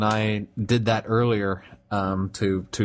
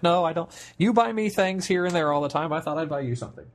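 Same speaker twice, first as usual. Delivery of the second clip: higher-pitched quote of a partner; imitated insistent partner; 8 semitones higher, faster than usual. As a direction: lightly voice a partner brushing off objections. Acts out partner insisting on gift.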